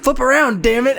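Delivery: high-pitched mock frustration at a gadget; exasperated cursing. Imitating a friend tapping at his phone, exasperated.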